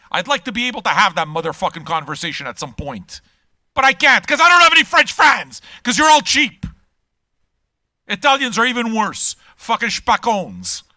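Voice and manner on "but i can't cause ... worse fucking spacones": much louder and higher, a hammed-up tirade; mock furious rant. Real, not acted. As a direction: blow up at the cheap French fans. Ranting at French fans.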